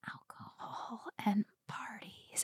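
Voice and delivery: conspiratorial whisper. Real, not acted. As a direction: lean in and whisper it. Confiding a secret to friend.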